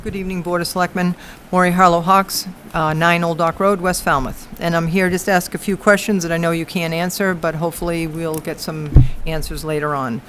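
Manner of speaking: quiet public comment, barely carrying; too quiet. Real, not acted. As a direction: speak softly, as if away from the mic. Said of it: "could you speak a little louder".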